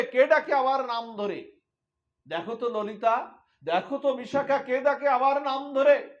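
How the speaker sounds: high, loud anxious pleading; urgently begging her friends to look; distraught cry. Acted. Radha crying to Lalita and Bishakha, distraught. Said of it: "এমন করে আর্তনাদ করে, বিকারগ্রস্ত হয়ে".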